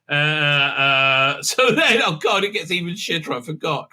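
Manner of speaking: ordinary delivery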